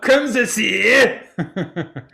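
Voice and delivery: high, loud, drawn-out French parody; mock French accent. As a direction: stretch it out in silly French, laughing. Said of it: "most racist French impression".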